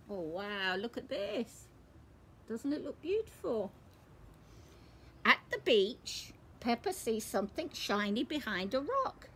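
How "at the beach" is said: usual speaking voice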